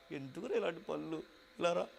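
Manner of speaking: mother crying softly. Retold or imitated. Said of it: "అని ఏడుస్తుంది".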